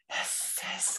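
soft sustained hissing; dragon hiss. Acted. Flames flaring from dragon's nostrils, hissing.

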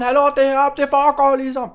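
loud, indignant call to the crowd. Acted. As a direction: get the chattering audience's attention. Calls out to chattering audience.

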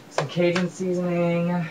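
drawn-out sing-song. Pretend. Drawn out fancy voice.

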